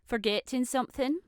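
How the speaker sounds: pointed reminder. Acted. Mother prompts the daughter to curtsy.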